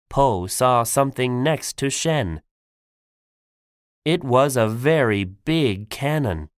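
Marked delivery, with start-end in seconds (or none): none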